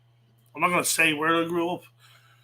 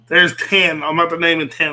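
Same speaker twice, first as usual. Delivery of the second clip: triumphant; 8 dB louder than usual. Real, not acted. Finishes listing ten streets.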